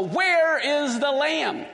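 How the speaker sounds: slow, high-pitched demand to know; insistent question. Acted. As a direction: stretch out the question, pressing for an answer. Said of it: "he wants to know".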